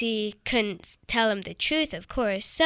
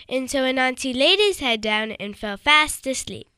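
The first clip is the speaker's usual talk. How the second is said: ordinary delivery, nothing marked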